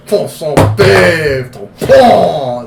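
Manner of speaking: put-on loud, caricatured French Canadian accent; French Canadian snob. Mocking a snobby French Canadian, hammed-up accent. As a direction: overblown French Canadian sounds, pushed high and loud. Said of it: "French Canadian asshole".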